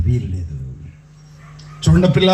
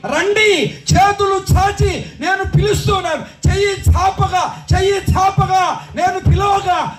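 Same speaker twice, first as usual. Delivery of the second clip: high, slow, drawn-out summons; calling out in preaching; 14 semitones higher, slower than usual. Acted. Preacher voices God calling with outstretched hands, high preaching cry. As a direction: cry it out, stretching each phrase.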